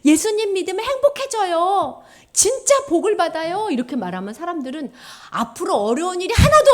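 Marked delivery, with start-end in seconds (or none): 0.0-3.7 high, loud evangelist's pitch; street evangelist. Acted. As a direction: call it out like someone evangelizing. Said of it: "전사님들이 이렇게 전도하거든". (전도하듯 높고 크게 외치는 말투)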